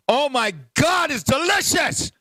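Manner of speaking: normal talking voice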